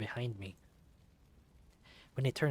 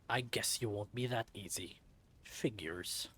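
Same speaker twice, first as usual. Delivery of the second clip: henchman voice; 2 semitones lower, 4 dB quieter than usual. Acted. Low henchman voice.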